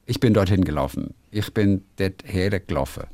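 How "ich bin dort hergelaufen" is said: ordinary talk, nothing marked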